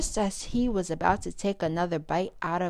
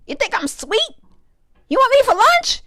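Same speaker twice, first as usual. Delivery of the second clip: high, loud frightened cry; alarmed; 12 dB louder than usual. Acted. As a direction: panic at the predator. Frightened parrotfish accuses shark.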